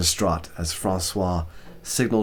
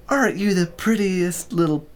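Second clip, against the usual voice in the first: cooing; 10 semitones higher than usual. A narrator's reading. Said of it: "cooing the silly words".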